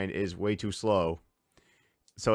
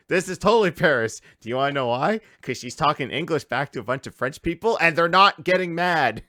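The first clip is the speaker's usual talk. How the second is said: louder, higher, insistent; emphatic retort; 5 semitones higher, 5 dB louder than usual. Retold or imitated. Answering chat about Paris, louder emphatic retort. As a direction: hammer the point home to the chat. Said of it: "I said".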